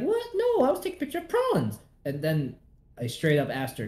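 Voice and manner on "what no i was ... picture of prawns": high, fast defensive denial. Retold or imitated. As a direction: deny it quickly, high.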